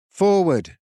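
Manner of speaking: rally the warriors with a loud high call; a knight calling his men forward, loud and high. A narrator's reading. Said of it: "he called".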